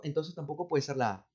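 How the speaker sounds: ordinary delivery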